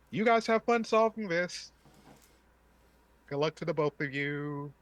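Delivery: loud high mocking send-off; sarcastic farewell. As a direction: toss it off sarcastically. NPC leaving, mocking cheer.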